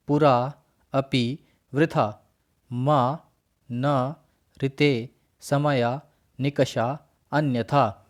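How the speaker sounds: each word said separately and clearly, with a pause after it; reciting Sanskrit words. Shown as a demonstration. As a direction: recite the word list carefully for the class. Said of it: "उनका उच्चारण कर लेते हैं". (अव्ययों का धीमा, स्पष्ट उच्चारण)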